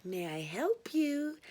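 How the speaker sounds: sweet slow receptionist coo. A narrator's reading. Narrator answers the next caller, sweet slow coo. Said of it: "I cooed".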